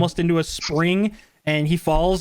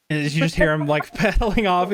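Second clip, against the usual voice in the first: normal talking voice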